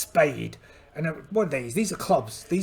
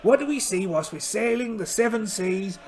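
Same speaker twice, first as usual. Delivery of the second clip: ordinary delivery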